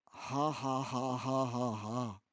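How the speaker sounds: low, soft mocking laugh of the camel; gloating laugh. Kamel lacht die Leute aus. (tiefes, spöttisches Lachen)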